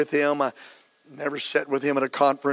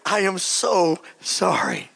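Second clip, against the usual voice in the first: drawn-out, syrupy fake condolence; milk the sorry like a slick undertaker; slow hypocrite sympathy, stretching the so; mock sympathy; 2 semitones higher, much slower than usual. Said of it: "funeral director ... saying".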